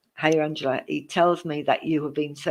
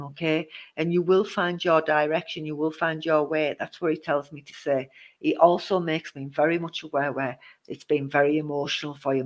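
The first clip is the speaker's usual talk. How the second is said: ordinary talk, nothing marked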